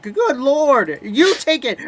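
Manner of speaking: high slow disbelieving outburst; exasperated outcry. Real, not acted. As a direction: plead with the quarterback in disbelief. Exasperated rant at quarterback.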